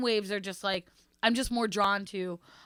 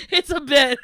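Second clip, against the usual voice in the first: said through laughter, louder; laughing; 4 semitones higher, 4 dB louder than usual. Real, not acted. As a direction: laugh the words out. Both joke and laugh.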